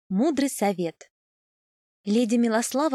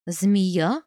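удивлённо; 3 semitones lower, 5 dB louder than usual. A narrator's reading. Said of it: "удивилась леди Аберлинг".

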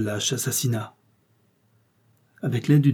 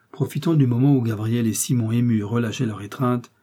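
ordinary delivery

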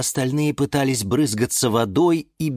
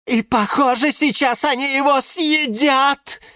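high-pitched alarmed report; Жорж reporting; 3 dB louder than usual. A narrator's reading. Flytrap glued to the screen, alarmed reporting. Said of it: "сообщил Венерина мухоловка Жорж".

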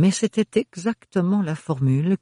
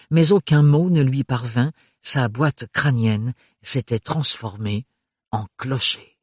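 ordinary talk, nothing marked

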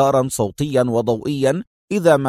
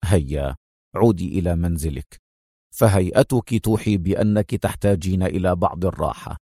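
low, softer firm man's voice; stern orders; 7 semitones lower, 3 dB quieter than usual. A narrator's reading. Adham tells the nurse his terms, low and stern. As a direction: give orders curtly. Adham sends Mona home.

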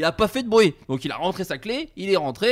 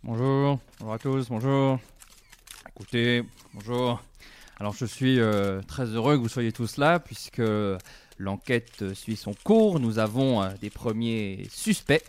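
judge on television; 6 semitones lower than usual. Acted.

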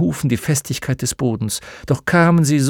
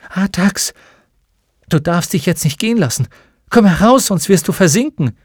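louder, higher pleading call; desperate plea; 6 semitones higher, 4 dB louder than usual. Acted. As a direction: shout to save him from sinking. Atreyu begging horse to fight.

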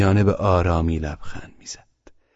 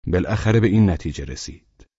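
ordinary delivery

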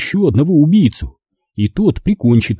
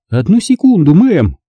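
brisk, higher phone voice; phone answer; 6 semitones higher, 4 dB louder than usual. Acted. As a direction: pick up the call. Polite on the phone. (мать отвечает на звонок)

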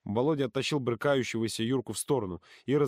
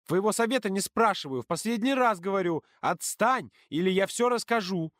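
high, insistent warning; heated, high-voiced ultimatum; angry threat; 9 semitones higher than usual. A narrator's reading. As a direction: deliver a final warning in a raised pitch. Threat in a quarrel.

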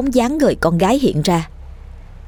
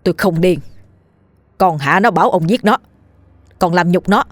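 mildly stern, insistent warning. Acted. Dần warning the old man to confess, sternly. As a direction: firmly press for the truth. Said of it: "Dần nghiêm giọng".

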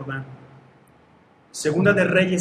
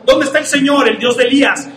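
higher, louder bold cry; calling out; 7 semitones higher, 6 dB louder than usual. Acted. Eliseo crying out to God at the Jordan. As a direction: call on God over the river.

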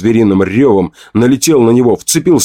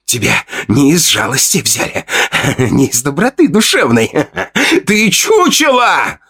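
much higher, slowed, rasping jeer; gloating insult; 6 semitones higher than usual. Acted. Fedya, sitting on the narrator's chest, jeers at him with contempt.

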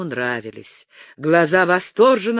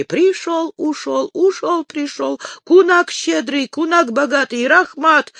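put-on much higher, mumbled old Tatar trader with broken Russian; a Tatar man muttering in a much higher voice; 12 semitones higher than usual. A narrator's reading. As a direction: mumble it high and eager like the Tatar horse-trader. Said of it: "забормотав не без придури".

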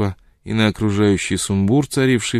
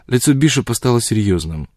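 ordinary delivery, nothing marked

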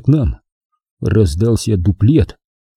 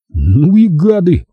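high, drawn-out indignant exclamation; slow, pitched-up indignation; 7 semitones higher, 3 dB louder, slower than usual. A narrator's reading. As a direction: stretch it out in outrage. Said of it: "возмутился Генка".

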